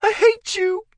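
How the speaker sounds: very high, loud cry. Acted. Wife crying out at husband, very high and loud. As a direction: hurl the hatred at him. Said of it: "the woman cried".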